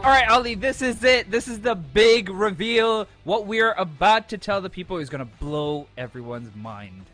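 loud, slow and high big reveal; hyped announcer. Acted. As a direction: blast it like a show intro.

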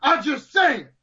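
agitated, high-pitched commentator rant; hammed-up outrage, ending high on the catchphrase; Booker T. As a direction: rant it out like an over-excited commentator. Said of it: "your Booker T morphs slightly into Ahmed Johnson".

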